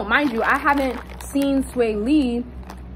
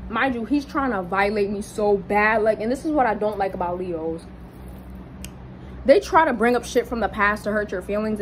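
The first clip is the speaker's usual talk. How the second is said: ordinary delivery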